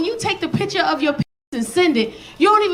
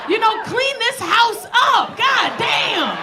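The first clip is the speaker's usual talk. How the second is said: high, drawn-out comic scolding; exasperated scolding; 7 semitones higher, slower than usual. Acted. Comedian telling men to clean up the house, exasperated scolding. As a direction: scold in a raised pitch, stretching the words. Scolding senders of messy photos.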